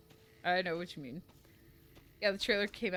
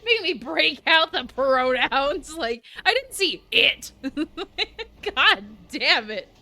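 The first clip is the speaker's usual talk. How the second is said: loud, high protest through laughter; crying with laughter; 7 semitones higher, 11 dB louder than usual. Real, not acted. Streamer protesting to Paul, loudly crying with laughter. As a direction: shout the excuse mid laughing fit. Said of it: "I'm crying".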